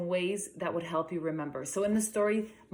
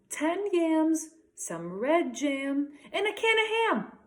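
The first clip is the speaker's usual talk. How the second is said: put-on higher little girl's voice reciting the list; child reciting; 9 semitones higher, 4 dB louder, slower than usual. Becky recites Mom's list to Josh, high and proud. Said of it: "Becky replied".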